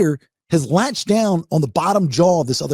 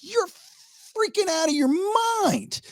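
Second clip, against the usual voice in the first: astonished disbelief; 4 dB quieter, slower than usual. Retold or imitated. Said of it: "I'm like".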